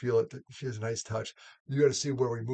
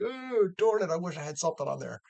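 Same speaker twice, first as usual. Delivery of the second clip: mock disappointment; 6 semitones higher than usual.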